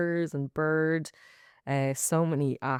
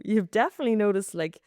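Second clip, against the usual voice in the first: usual speaking voice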